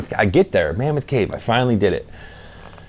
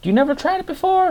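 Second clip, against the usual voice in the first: much higher, fast astonished question; surprised waitress; faster than usual. Retold or imitated. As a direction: squeal in disbelief like the waitress. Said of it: "She's like".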